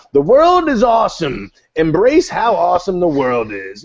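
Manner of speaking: loud, high upbeat preaching; hammed-up joyful evangelist; charismatic street preacher. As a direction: proclaim it to a street crowd. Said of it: "I can be charismatic at times".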